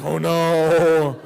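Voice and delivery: very loud, drawn-out comic cry of dismay; mock wail. Speaker acting his reaction to a silly answer, loud mock wail. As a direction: bellow it long in hammed-up dismay.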